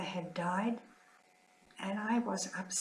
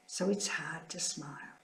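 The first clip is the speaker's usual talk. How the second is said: whispered grief; 2 semitones lower, 4 dB quieter than usual. Real, not acted. Nun grieving at sister's passing, whispered. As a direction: whisper it through real sorrow.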